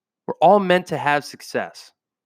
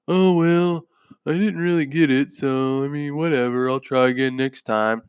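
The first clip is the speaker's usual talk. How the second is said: mock complacent shrug. Mocking his old complacent self.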